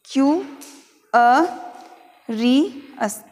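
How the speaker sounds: teacher spelling out sounds; syllable by syllable. Shown as a demonstration. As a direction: break Q अ री अस with long pauses. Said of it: "किस प्रकार से बोलना है".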